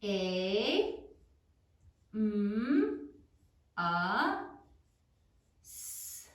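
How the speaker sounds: "A, M, a, s" sound by sound, long pauses between; "A, M, a, s" as separate drawn-out sounds. Shown as a demonstration. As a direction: sound out "A, M, a, s" slowly for someone writing. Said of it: "say it as you write it".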